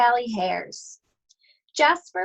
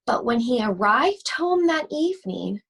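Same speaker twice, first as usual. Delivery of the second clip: plain reading voice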